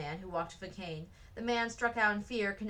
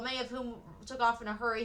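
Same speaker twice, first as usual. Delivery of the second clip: plain reading voice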